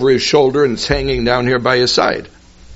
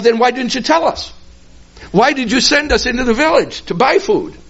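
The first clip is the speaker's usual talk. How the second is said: puzzled disciples; 9 semitones higher, faster than usual. Acted. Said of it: "they're puzzled".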